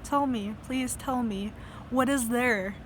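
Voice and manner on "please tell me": ordinary talk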